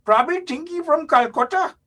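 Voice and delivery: high-pitched mock Indian accent scolding. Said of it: "No, that's Indian voice".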